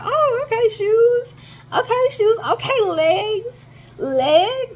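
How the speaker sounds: very high, loud mock amazement; proud self-hype. Runner cheering on their own legs after a dash in the rain, high proud squeal. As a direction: squeal in stretched-out praise of your own body. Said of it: "I was actually proud of myself".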